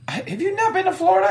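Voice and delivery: laughing disbelief, fast and high; can't believe it, laughing; incredulous laugh. Real, not acted. Incredulous laughing at Florida claim.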